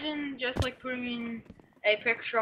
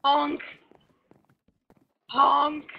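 silly clown; 2 semitones higher, 5 dB louder than usual. Pretend. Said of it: "anything more annoying".

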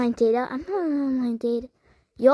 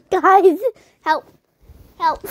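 mock cry for help; 4 semitones higher, 7 dB louder, slower than usual. Real, not acted. Calling for help jokingly.

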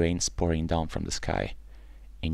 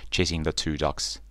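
ordinary delivery

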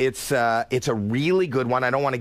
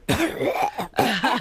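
imitated cough; 10 semitones higher than usual. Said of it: "like that".

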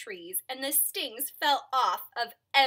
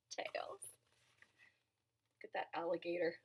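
hushed unvoiced word; soft whispered ending; 5 semitones lower, 10 dB quieter, faster than usual. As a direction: drop suddenly to a whisper. Reader drops voice for punchline.

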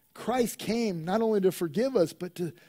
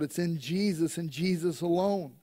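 ordinary talk

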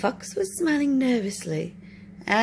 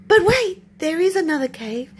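high, loud quick call; urgent fox; 7 semitones higher, 5 dB louder than usual. Acted. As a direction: stop him before he leaves. Fox stopping bear leaving.

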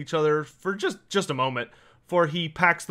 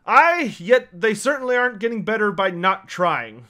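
louder, higher dwarf voice on a big "Aye"; grumbling dwarf; 4 semitones higher, 5 dB louder than usual. Acted. Dwarf agrees about shoddy builders, emphatic character grumble.

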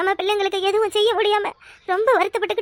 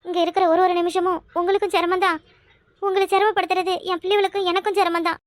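usual speaking voice

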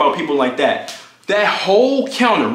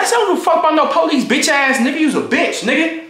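fast, much higher yelling with a laugh; heated yell; 10 semitones higher than usual. Retold or imitated.

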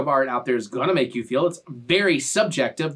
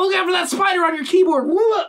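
high, loud fake panic; hammed-up alarm rising to a yelp; mock alarm; 13 semitones higher, 4 dB louder than usual. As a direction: shout a prank warning in pretend fright. Prank warning of a spider.

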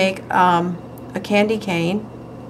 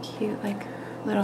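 whisper; 13 dB quieter than usual. Real, not acted. Whispering while painting.

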